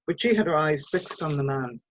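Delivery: plain reading voice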